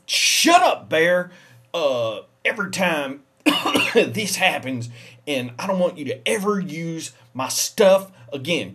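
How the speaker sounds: loud furious tirade at a roommate; angry rant. Acted. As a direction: tell him off at full volume. Yelling at the bear.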